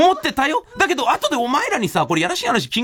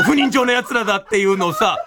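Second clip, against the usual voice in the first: ordinary talk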